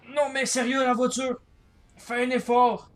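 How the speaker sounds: high, loud exasperated shouting; exasperated yell. Real, not acted. Rage at the car. (cri exaspéré)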